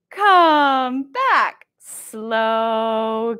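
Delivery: slow, drawn-out call; slow bird coming back. Shown as a demonstration. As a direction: stretch the words lazily.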